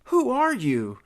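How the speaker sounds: demanding. Acted. Mary Ann standing her ground before intruders, demanding. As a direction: demand it, pitched well up. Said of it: "demanded my love".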